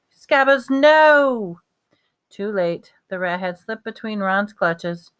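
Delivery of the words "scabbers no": high, loud cry of alarm; alarmed shout. A narrator's reading. Ron as the rat slips from his clutches, alarmed shout. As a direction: call out desperately, stretching the last word. Rat escapes as cat nears.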